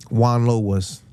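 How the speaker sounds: normal talking voice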